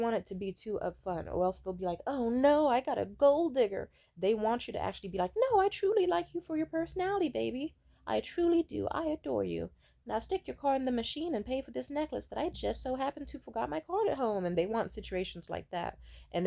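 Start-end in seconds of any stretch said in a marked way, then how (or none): 2.1-3.9 loud, high, hammed-up alarm; exaggerated imitation of a panicked man; mock dismay. Mocks men's dismay at gold diggers. 5.3-14.4 high, put-on sweet-talking voice; butter him up in a high girlish act; exaggerated mock devotion, pitched way up; fake adoring girlfriend. Acts fake-adoring girlfriend flattering a man.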